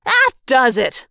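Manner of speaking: exasperated shout; yelled outburst. A narrator's reading. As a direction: blow up at the teasing. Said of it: "I yelled".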